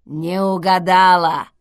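loud, rasping announcement; hoarse intercom voice. A narrator's reading. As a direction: rasp it out loudly. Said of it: "хрипло сообщил домофон". (хрипло и громко)

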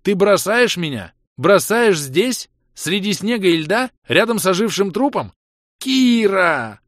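high, loud, pleading cries; desperate shouting. Acted. Ros shouts after Kira, begging her not to leave. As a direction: scream it as she abandons you.

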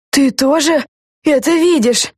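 panting, slow and high; out of breath. A narrator's reading. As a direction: gasp it out after running. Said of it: "тяжело дыша проговорила Люся".

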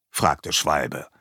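plain reading voice

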